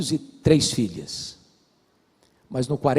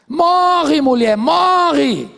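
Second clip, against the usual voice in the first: high, loud cry with a slow drawn-out "morre"; mock shout back; 12 semitones higher, 8 dB louder, much slower than usual. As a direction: fling it back at her. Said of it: "o que que vocês acham que ele falaria".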